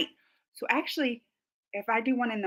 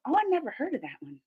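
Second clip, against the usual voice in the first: ordinary talk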